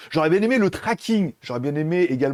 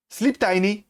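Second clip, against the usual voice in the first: French-accented English command; 4 semitones higher, slower than usual. Shown as a demonstration. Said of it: "elle comprend mon anglais de merde".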